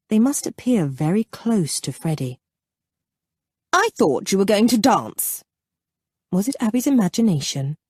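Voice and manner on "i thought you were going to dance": higher, faintly put-out remark; a touch of jealous accusation; almost jealous. A narrator's reading. Freddie finding Abby beside the Baron, almost jealous. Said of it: "did Freddie sound almost jealous?".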